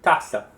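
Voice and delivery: Taza called out clearly as TA-za; first-syllable stress. Shown as a demonstration. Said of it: "I'm going to say a word".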